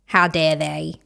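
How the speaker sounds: low mock-indignant Australian accent; bad Australian accent. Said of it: "the worst Australian accent".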